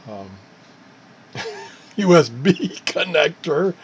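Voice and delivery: ordinary talk, nothing marked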